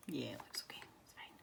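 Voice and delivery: whispered self-talk over the drawing. Real, not acted. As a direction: hush it out as if alone at the desk. Muttering to herself while drawing.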